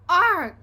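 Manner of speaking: loud high gurgling cry; gurgled. A narrator's reading. Grandpa gurgling as water hits him, loud cry. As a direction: splutter under the water. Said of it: "he gurgled".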